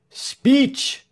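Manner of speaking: high, carrying call; crying out. A narrator's reading. Someone at crowd's edge demanding speech, crying out. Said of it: "cried someone from the edge of the crowd".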